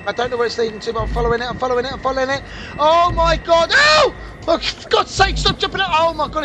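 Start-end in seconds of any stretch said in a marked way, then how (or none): 2.8-4.2 loud, very high shriek of fright; startled shriek. Real, not acted. Gamer shrieking as monster jumps out, loud high cry. Jump scare, creature jumps out.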